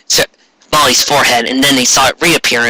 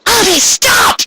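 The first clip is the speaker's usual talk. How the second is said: much higher pleading shout; desperate shout; slower than usual. Acted. Ray begging Ami to stop, high shout. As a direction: call after her as she leaves.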